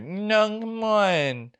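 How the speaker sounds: lightly imitating the girlfriend's whine.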